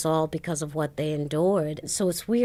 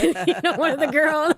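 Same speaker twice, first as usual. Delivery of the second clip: laughing through the words, higher; giggling aside to the host; 10 semitones higher, 4 dB louder than usual. Real, not acted. Laughing while telling the host about the group-home girls. Laughs at her own story.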